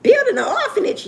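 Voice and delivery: high, loud incredulous mom; imitating mama, high and loud; mama shocked. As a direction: echo it back like a stunned mother. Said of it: "mama voiced in retold phone call".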